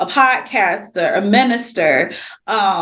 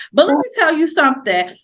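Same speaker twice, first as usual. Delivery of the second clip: ordinary talk, nothing marked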